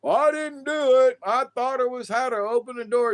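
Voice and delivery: high, loud, hammed-up protest of innocence; mock excuse. Mimicking a congressman caught pulling the fire alarm, mock excuse.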